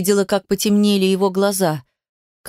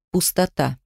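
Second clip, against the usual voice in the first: ordinary delivery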